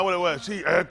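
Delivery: normal talking voice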